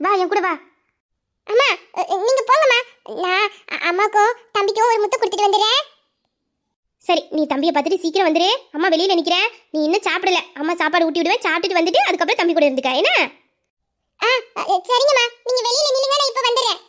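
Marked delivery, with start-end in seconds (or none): 1.5-5.8 put-on high-pitched small child's voice; little child. Child asking to kiss mother and baby, childlike. 14.2-16.8 put-on high-pitched small child's voice; little child. Child asking to kiss mother and baby, childlike.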